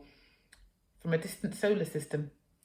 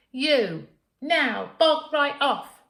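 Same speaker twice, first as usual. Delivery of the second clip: higher, loud, slow "bog right off"; defiant shout; 9 semitones higher, 8 dB louder, much slower than usual. Acted. Girl defying her mother, higher and loud. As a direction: spit it back, stubborn.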